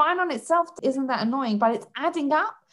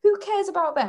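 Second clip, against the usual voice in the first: high scoffing "who cares", pitched up; scoffing disbelief; 8 semitones higher than usual. Retold or imitated. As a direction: brush it off with mock disbelief. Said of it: "I think to myself".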